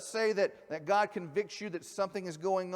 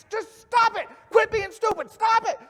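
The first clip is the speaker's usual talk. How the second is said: higher, louder shouted "just stop it"; psychologist screaming; 12 dB louder than usual. Retold or imitated. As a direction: yell it at him like a fed-up therapist. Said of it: "he just starts screaming at the guy".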